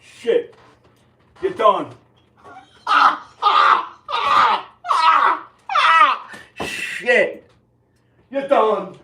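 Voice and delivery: loud, high outburst; high-pitched shout; angry yelling. Real, not acted. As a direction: yell it out in short bursts. Worked-up outburst at someone.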